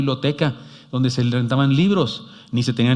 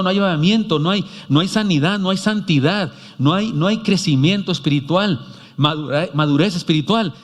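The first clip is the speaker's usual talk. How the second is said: preaching; 5 semitones higher than usual. Acted. Preaching at full force.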